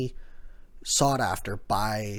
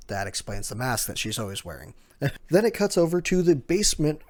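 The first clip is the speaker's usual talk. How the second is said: usual speaking voice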